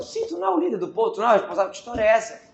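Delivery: high, fast mock disbelief; sarcastic teacher retort to Moses's complaint. Real, not acted. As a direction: throw the irony back at him.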